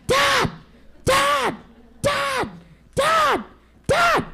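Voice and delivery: very high, loud repeated calls; calling out. Acted. Little wolf pestering his father, high loud calls. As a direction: keep calling dad urgently.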